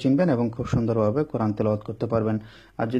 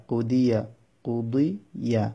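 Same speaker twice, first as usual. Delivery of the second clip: কুদিয়া drawn out slowly with a gap before yeah; slow syllables; much slower than usual. Shown as a demonstration. Teacher demonstrating a word for learners, slow syllables. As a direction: stretch কুদিয়া part by part.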